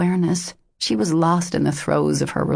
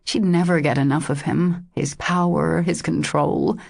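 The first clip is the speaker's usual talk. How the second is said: ordinary delivery, nothing marked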